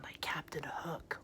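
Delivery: breathy secret whisper. Acted. Teacher telling the kids a secret, soft whisper. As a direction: lean in and whisper it.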